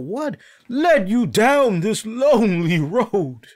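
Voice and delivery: loud, high, drawn-out dramatic question; hammed-up melodramatic plea, stretched slow; mock dramatic interviewer. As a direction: belt it out like an overwrought interviewer.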